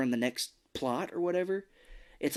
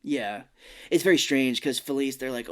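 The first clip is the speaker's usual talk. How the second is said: ordinary talk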